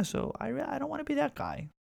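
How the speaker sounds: usual speaking voice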